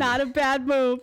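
loud, slow, emphatic approval; impressed praise. Real, not acted. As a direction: praise it loudly like you're genuinely impressed. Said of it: "Plugging your own content".

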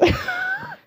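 a sudden high squeal of laughter at herself. Real, not acted. Podcaster cracking up at her own joke, high squealing laugh. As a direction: burst into a high, giddy laugh.

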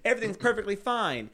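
high-pitched mock-cheerful insistence; forced cheer. Quoting a high-school self who hid being bullied, forced cheer. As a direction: insist a little too brightly that all is well.

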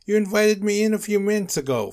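high, loud indignant protest; shouting. A narrator's reading. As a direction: shout it in exasperation. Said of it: "Nosferatu shouted".

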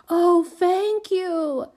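put-on high, loud, drawn-out little-girl trill; trilled thanks. A narrator's reading. Small child thanking the carter, trilling. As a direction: trill it out slowly, delighted. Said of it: "trilled the par-, prodigy".

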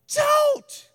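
very high, loud warning shout; scream. Acted. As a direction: scream it out across the street. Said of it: "Your Bible says she's screaming".

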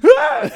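shrill laughing mock scream; high laughing mock scream. Mop boss screaming at the worker, hammed-up high scream.

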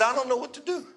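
high, soft, helpless voice, imitated; imitated tearful woman. Stranded girl to the pastor, imitated soft distress. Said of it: "she's crying and she tells me this story".

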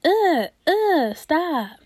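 high-pitched, repeated grossed-out groans; disgusted recoil. Acted. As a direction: whine in disgust, pushing it away. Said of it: "And I'm just like".

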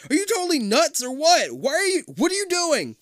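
high, loud exasperated yelling; exasperated shout. A narrator's reading. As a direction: yell at a crazy friend. Shouting at Todd.

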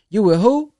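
high, loud disbelieving squawk; incredulous "you with who". Retold or imitated. Resident squawking at the final call brother, incredulous.

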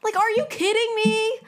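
are you kidding me, real frustration; heated disbelief. Real, not acted. Scolding followers who party in basements, exasperated. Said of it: "I'm getting so heated".

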